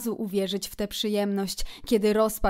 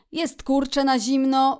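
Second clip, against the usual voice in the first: higher, louder opening of a list; brisk listing; 4 semitones higher, 5 dB louder than usual. A narrator's reading. Szczur lists the basket's food for Kret, concise listing. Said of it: "wyliczał zwięźle szczur".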